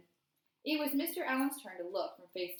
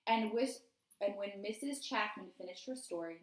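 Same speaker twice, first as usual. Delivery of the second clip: ordinary delivery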